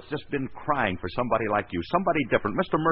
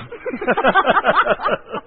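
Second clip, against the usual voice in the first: loud laugh; 14 semitones higher, 6 dB louder than usual. Real, not acted. Laughs at absurd screen test.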